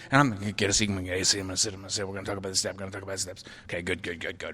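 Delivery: nervous inner voice. Retold or imitated. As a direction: mutter it to yourself, fading away. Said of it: "I'm walking in a meeting".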